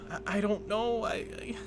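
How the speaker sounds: high, faltering, close to tears; tearing up. Acted. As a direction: stammer and fade as tears come. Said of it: "she starts to tear up a bit".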